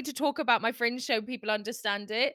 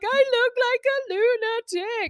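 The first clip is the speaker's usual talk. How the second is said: mock wail; 12 semitones higher than usual. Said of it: "because I was like".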